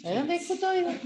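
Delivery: hammed-up Cádiz accent, high, dropping the s sounds; "escutoides" said as "ekutoia", swallowing the s and d like Cádiz. Shown as a demonstration. Speaker to students, mock Cádiz accent. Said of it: "cuando la gente habla así".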